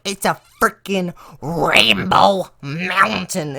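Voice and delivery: hammed-up indignant punchline, drawn out; mock furious teacher. Teacher yelling at laughing students about the mountain, mock anger. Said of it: "she's so mad".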